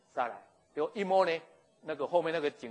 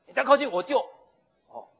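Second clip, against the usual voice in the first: high, loud threat; warning shout; 10 semitones higher, 12 dB louder than usual. Acted. Sarai warns Pharaoh, loud and high. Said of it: "他说".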